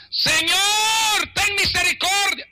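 high wailing shout for mercy; crying out. Acted. Canaanite woman calls after Jesus a second time, wailing shout. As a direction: stretch the first word into a high cry. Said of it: "el otro grito que ella pega".

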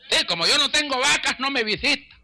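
high-pitched imitated grievance; mock whining complaint. Neglected member complains about pastor's visits, mock whining. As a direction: whine it high like a sore member. Mimicking a resentful church member.

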